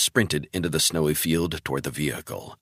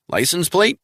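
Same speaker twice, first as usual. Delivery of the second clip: high, loud demanding voice; urgent boss on the phone; alarmed handler; 13 semitones higher, 4 dB louder than usual. A narrator's reading. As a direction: Travell demanding answers.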